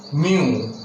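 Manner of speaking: "mu" said clearly, y-oo glide; U diphthong demo. Shown as a demonstration. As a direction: model the yu sound in "mu". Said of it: "the sign for the U diphthong".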